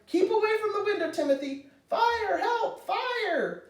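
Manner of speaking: very high-pitched cries of alarm; urgent father calling for help; panicked yelling. A narrator's reading. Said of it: "yelled Timothy's father".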